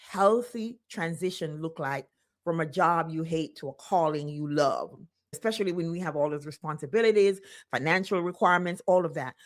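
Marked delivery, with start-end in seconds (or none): none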